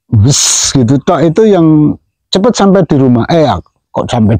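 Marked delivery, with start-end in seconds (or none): 0.1-0.7 one smooth, hissed "wes"; single whoosh. Shown as a demonstration. Explaining which bird lands fastest, single imitated whoosh. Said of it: "Justru yang suaranya cuma".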